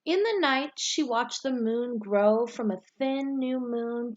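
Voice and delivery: put-on reading a picture book aloud to children, lively and rising at each new season; bright, expressive read-aloud storyteller voice; story narration. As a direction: tell it like story time, lifting the start of each season. Teacher reads storybook aloud.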